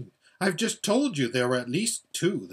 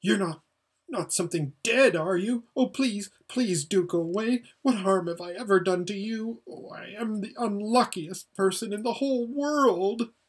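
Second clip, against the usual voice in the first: put-on high, pleading, frightened boy; terrified high-pitched wail of self-pity; almost screaming; 7 semitones higher than usual. A narrator's reading. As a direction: beg in rising panic, voice pitched way up. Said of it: "almost in a scream".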